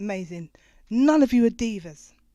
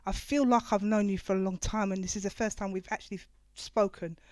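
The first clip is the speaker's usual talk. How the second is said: choked up; 3 semitones lower, 8 dB quieter than usual. Real, not acted. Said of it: "don't even let me get my tissues out here".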